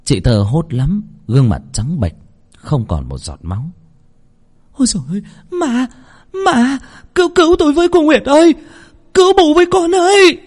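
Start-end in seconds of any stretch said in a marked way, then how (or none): 4.7-10.4 much higher and louder, fast desperate cries for help; terrified screaming. Acted. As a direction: shriek for help in panic. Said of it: "Bởi lẽ chị hét to lắm".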